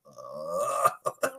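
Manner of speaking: low, drawn-out "ugh"; disgusted groan. Retold or imitated. Viewer reacts to the creepy princess line, disgusted groan.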